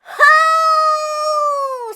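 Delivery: high, loud straining shout; a big group heave, yelled high. Acted. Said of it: "Sophia shouted".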